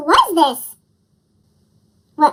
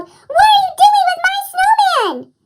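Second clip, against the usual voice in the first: calling out; 13 semitones higher than usual. A narrator's reading. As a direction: shout up to the ship. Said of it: "Owen ran out of the house and called".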